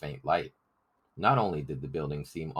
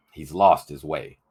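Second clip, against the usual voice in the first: louder, a stern angry verdict; 9 dB louder than usual. A narrator's reading. John Ball rejects Bear before the rebels, angrily. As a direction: snap it out in disgust. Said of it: "John Ball said angrily".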